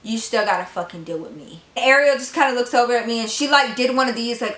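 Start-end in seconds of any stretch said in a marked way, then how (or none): 0.0-1.6 silent stare. Retold or imitated. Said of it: "staring at Ariel like".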